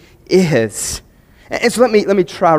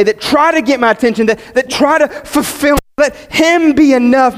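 higher, building sermon cry; impassioned preaching; 6 semitones higher than usual. Real, not acted. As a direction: press it home with fervour.

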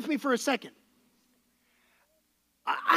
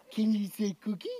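put-on coaxing baby talk; 6 semitones lower, 5 dB quieter than usual.

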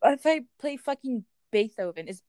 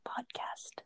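soft unvoiced whisper intro; ASMR whisper; 14 dB quieter than usual. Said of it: "Do, should I do some A S M R?".

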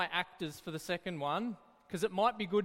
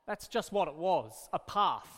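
ordinary delivery

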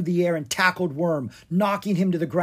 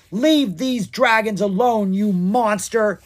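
slow, louder, higher defiant shout; defiant yell; 8 semitones higher, 6 dB louder, slower than usual. Acted. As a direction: stand up to the monster. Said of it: "he yelled".

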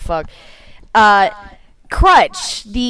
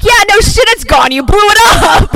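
louder, higher, laughing exasperation; exasperated sarcasm; 9 semitones higher, 4 dB louder than usual. Retold or imitated. Host answering a film character's line, loudly sarcastic. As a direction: throw it back as mock-outraged obviousness. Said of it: "I'm like".

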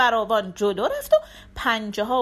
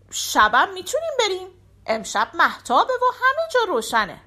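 higher, lightly voiced cat; cat's reply; 7 semitones higher than usual. A narrator's reading. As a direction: answer eagerly as the cat. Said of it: "هینسه گفت". (گربه با صدای زیرتر و سبک)

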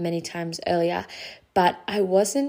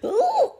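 very high squeaked ooh; mock squeal of overwhelm; 4 dB louder than usual. Overwhelmed mom reacting to husband's greeting, high squeak. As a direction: squeak it out as if snapping. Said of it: "I'd be like".

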